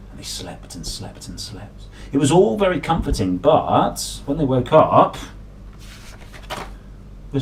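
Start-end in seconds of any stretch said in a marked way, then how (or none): 0.1-1.8 soft, breathy sleepy refrain; sleepy whisper. A narrator's reading. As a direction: let it drift off like falling asleep.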